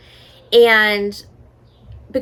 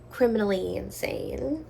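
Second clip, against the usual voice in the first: hushed spooky whisper; dramatic whisper; 9 dB quieter than usual. Pretend. Whispering to viewers about the criminally insane guys. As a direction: whisper it like a dark secret.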